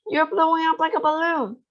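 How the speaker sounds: high panicked mother voice. Acted. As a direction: cry it in alarm.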